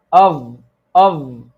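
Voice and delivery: "av" with a thick th, tongue poking out; tongue too far out. Shown as a demonstration. Teacher imitates Pakistani and Indian students' mistake, tongue out. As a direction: stick the tongue out on the end of "av". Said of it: "put the tongue out".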